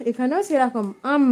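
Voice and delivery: normal talking voice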